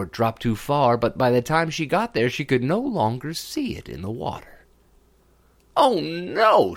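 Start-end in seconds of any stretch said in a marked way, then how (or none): 5.8-6.7 high dismayed moan; a princess wailing over her lost ball. Acted. Princess sees her ball vanish in the well, moaning. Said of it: "she moaned".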